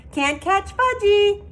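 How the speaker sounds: put-on high, loud taunting toddler; hollering. Toddler running off and taunting, high and loud. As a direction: tease the chasers at full voice. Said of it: "he hollered".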